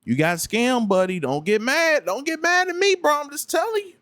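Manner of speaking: high-pitched acted telling-off, climbing sharply midway; putting them in place. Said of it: "put them in a place and be like".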